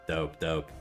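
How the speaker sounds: ordinary talk, nothing marked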